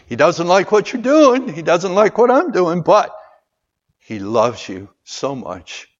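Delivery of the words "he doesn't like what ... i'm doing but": loud, high preacher's call; rising sermon fervor; full-force preaching. Acted. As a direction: preach it out to the whole room.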